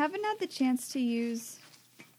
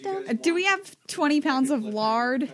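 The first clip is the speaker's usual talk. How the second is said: slow, drawling Midwestern pioneer voice; Midwestern accent; 4 semitones higher, 5 dB louder, slower than usual. Pretend. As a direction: ask it like a Midwestern settler. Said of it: "do my Midwestern accent".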